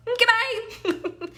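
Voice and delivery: "Mm, goodbye." slow, high and teasing; mock breezy goodbye. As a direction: drawl a dismissive goodbye with a laugh. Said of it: "You're like".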